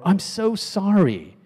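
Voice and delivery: high, slow, gushing sorry; hammed-up heartfelt apology; over-sincere apology. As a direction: plead it, stretching the words. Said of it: "they might have said".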